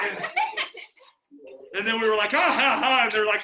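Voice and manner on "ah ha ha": loud acted laughter; mocking laugh. Retelling friends after scaring the girls, mock laughing. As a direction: laugh at your victims.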